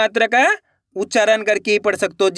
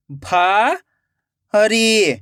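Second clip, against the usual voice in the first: भारी split into भा, long pause, री; rising भा, then falling री; syllable by syllable; much slower than usual. Shown as a demonstration. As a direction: sound out भारी very slowly in two parts. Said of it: "एक एक अक्षर का उच्चारण करना है".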